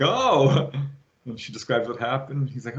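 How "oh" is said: startled son. Retold or imitated.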